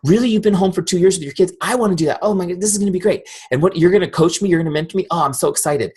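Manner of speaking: high, breathless gushing over the plan; squealing with excitement; gushing excited friend. Retold or imitated. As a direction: play the thrilled new recruit who can't contain herself. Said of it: "She's like".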